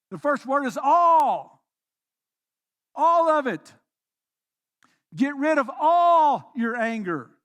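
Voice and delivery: all hit hard, loud and slow; stress on all. Real, not acted. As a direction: punch the word all every time. Preacher stressing the word all.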